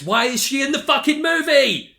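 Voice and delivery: high, loud exasperated outburst; exasperated rant. Acted. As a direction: blurt it out in mock outrage. Ranting about pointless character.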